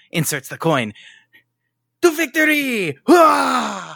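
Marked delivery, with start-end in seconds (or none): 2.0-3.9 high, loud heroic cheer; rally like a hero leaving; heroic battle cry. Acted. Wrestler heroic exit.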